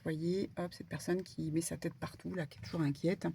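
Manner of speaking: ordinary talk, nothing marked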